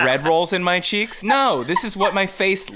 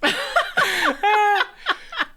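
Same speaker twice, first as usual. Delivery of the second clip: high burst of laughter. Real, not acted. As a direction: crack up high. Laughing at Rachel's picture.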